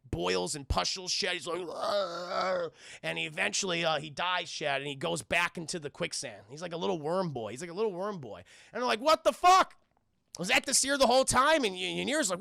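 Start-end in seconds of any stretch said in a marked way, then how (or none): 1.6-2.7 long, strangled monster groan; mock dying groan. Monster's skin breaking out in boils, mock agonized groan. 9.0-11.6 high, loud disbelief; mock shocked outburst. Companions learning the seer was an impostor, mock shocked outburst.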